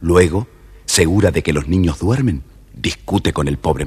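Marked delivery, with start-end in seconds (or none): none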